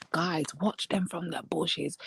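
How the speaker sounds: unvoiced conspiratorial whisper. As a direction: whisper it like a secret tip. Conspiratorial tip to viewers.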